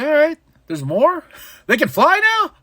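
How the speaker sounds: mock astonished question; incredulous. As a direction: ask in disbelief. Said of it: "I was just like".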